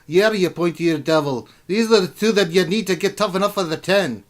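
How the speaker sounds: rousing dwarf welcome speech, high and loud; dwarf king. Acted. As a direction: address recruits as a tough dwarven ruler.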